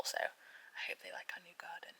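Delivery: soft hushed aside; quiet hopeful whisper. Real, not acted. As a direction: whisper it as a little wish.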